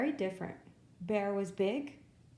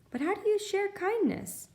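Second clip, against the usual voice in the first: much higher questioning character voice; a hint of Bear; 11 semitones higher, 3 dB louder than usual. A narrator's reading. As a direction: ask it like a puzzled young bear.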